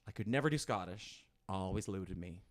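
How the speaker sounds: ordinary delivery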